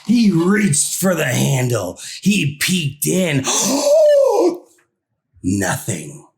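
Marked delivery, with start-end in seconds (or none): 3.4-4.6 high, loud frightened gasp; sharp gasp. A narrator's reading. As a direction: suck in air in fright. Scared discovery in the dresser.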